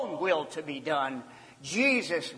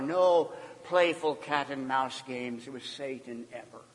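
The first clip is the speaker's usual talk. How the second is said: usual speaking voice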